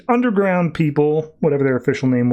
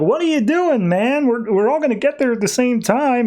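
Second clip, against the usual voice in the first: raised pitch, mocking the soldiers; high-pitched soldiers' voice; 11 semitones higher than usual. Soldiers complaining to Superman in a high mock voice. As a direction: squeak it out like pushy soldiers. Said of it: "all the guys are like".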